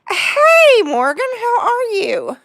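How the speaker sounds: excited greeting. Real, not acted. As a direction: greet a friend with delight. Greeting chat viewer excitedly.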